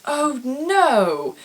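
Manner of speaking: a drawn-out "oh no" in fake British; terrible British accent. Actor hears they can't get in, mock British dismay. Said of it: "It's a terrible British accent".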